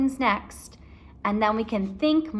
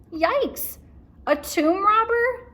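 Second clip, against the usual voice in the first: high, louder yelp of fright; scared Annie; 12 semitones higher, 5 dB louder, slower than usual. A narrator's reading. Annie hearing about the tomb robber, frightened yelp. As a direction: squeak it out in alarm. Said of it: "said Annie".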